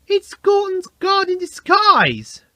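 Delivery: very high, slow excited shout; shouting James. A narrator's reading. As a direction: shout the reveal. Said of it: "shouted James".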